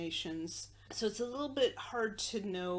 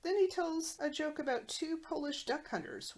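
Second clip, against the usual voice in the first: ordinary talk, nothing marked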